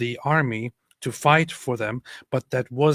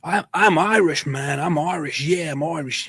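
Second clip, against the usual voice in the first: mock fake Irishman; 3 semitones higher, 5 dB louder than usual. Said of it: "people who are like".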